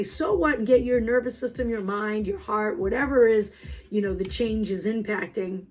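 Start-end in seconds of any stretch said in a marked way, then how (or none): 0.0-0.6 dismissive so what. Shown as a demonstration. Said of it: "if you can say".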